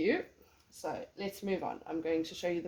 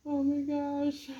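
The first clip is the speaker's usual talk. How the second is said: surprised; 9 semitones higher, 4 dB louder, slower than usual. Real, not acted. Spots cat hair on machine.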